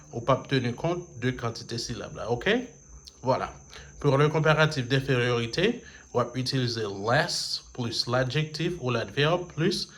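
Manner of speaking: normal talking voice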